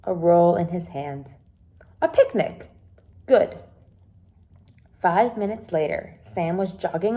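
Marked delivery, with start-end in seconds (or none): 2.0-3.6 higher, lightly voiced cheerful man; cheerful Onji. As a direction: give Onji a quick pleased reply. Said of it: "Onji looked up".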